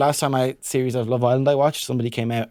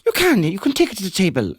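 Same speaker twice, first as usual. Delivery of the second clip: high, teasing mock Irish accent; exaggerated Irish accent, pitched up; mimicking an Irish accent; 6 semitones higher, 4 dB louder than usual. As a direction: parody an Irish lilt. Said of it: "She's like".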